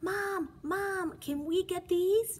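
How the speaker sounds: put-on high, loud little-kid begging; pleading child. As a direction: beg mom like a small bunny. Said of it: "Jasper pleaded".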